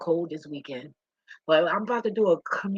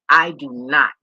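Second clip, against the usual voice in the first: louder, blurted start, then cut off; 9 dB louder than usual. Real, not acted. Starting a firm statement, loud, then breaking off. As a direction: blurt it out and stop short. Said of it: "Imma say it again".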